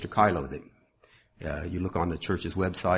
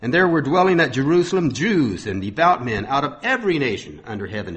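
raised, ringing sermon reading voice; scripture reading; 5 semitones higher, 6 dB louder than usual. Acted. Preacher reading Acts to his congregation, proclaiming.

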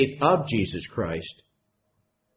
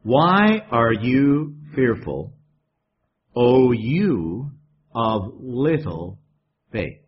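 a gentle chiding question; calm gentle rebuke; 3 semitones lower, much slower than usual. Acted. Christ calmly asking his frightened disciples. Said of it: "Christ has to know".

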